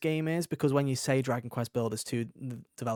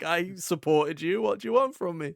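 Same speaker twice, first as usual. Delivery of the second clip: mock-wounded, a touch higher; defensive Charmander; 4 semitones higher than usual. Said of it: "he's like".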